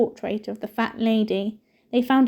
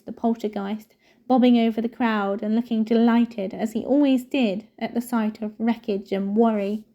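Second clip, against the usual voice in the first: ordinary delivery, nothing marked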